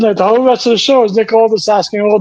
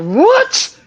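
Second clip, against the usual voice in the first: surprised; 12 semitones higher than usual. Retold or imitated. Shocked at the replacement news.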